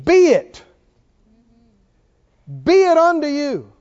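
loud, high decree; forceful preacher's proclamation; commanding. Retold or imitated. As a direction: ring it out as a command. Quoting Jesus' spoken word.

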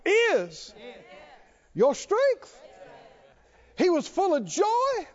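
high-pitched, driving sermon delivery; fervent preaching. Real, not acted. Preacher exults in Brother Vic's joy, fervent high preaching.

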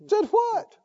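very high, puzzled question; imitated bafflement. Preacher imitating the smoker caught saying it, baffled. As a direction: play the clueless innocent. Acts out the man's baffled reply.

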